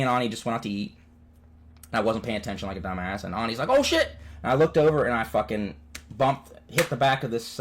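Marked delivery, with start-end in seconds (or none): 3.7-4.2 high startled oh shit; sudden alarm at the car ahead. Retold or imitated. Ani spotting the crash coming, a high startled cry.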